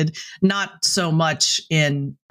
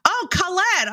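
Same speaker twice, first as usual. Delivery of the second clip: mocking high voice; 14 semitones higher than usual. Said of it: "are like".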